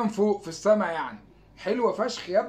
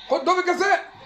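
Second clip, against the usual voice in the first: excited shout; 10 semitones higher, 5 dB louder than usual. Real, not acted. Shouting for a penalty.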